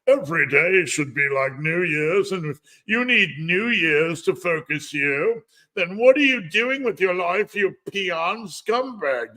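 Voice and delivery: mock a smug influencer lecturing his followers; mocking a preachy influencer. Mocking smug social media influencers for live viewers, in a high sneering voice. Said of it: "these lame social media influencers who are like".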